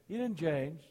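normal talking voice

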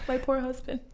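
teasing pity for her husband; mock pity. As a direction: sigh it out in pity for him. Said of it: "I was like".

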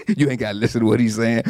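low, fast sneering aside; low quick sneer into someone's ear; low fast mocking gossip; sly gossip. Whisperer undermining Paul.

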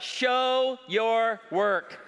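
drawn-out math teacher command. Retold or imitated. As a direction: spell it out very slowly like a strict teacher. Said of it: "the math teacher said what?".

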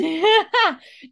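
high burst of real laughter; real laughter, high and loud. Real, not acted.

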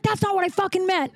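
high-pitched mock indignation; retold anger. Raider fan protesting her sarcastic offer, imitated indignation. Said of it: "then he got mad".

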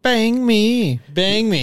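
hammed-up nasal "Bang me"; exaggerated nasal imitation. Learner overdoing the friend's pronunciation, exaggerated nasal "Bang me".

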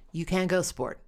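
ordinary delivery

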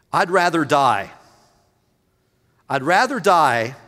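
loud anguished cry, I'd rather die; shouted despair. Retold or imitated. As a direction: hurl it out as Jonah's protest.

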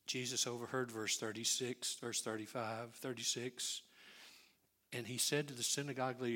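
usual speaking voice

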